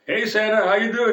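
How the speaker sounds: high, loud hello to Santa; excited greeting. Retold or imitated. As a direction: call out a cheery acted greeting. Acts out greeting the hospital Santa.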